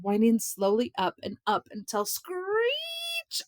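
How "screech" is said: high, long, held siren-like screech. A narrator's reading. Narrator imitates the siren winding up, a high drawn-out screech. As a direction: stretch the word into a rising siren. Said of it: "The siren growled".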